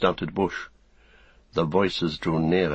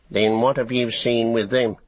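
higher, louder goblin snarl; snarling orc tracker; 4 semitones higher, 4 dB louder than usual. Acted. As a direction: snap it back like a cornered orc. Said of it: "snarled the other".

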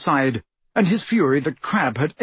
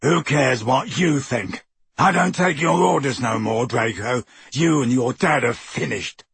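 ferocious. A narrator's reading. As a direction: turn on him with ferocity. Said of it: "with undisguised ferocity".

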